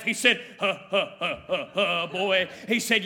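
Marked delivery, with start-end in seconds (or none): 0.6-2.5 put-on lower, chuckling old preacher's laugh; low hearty imitated chuckle; belly laugh. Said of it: "billowed in that big old belly laugh".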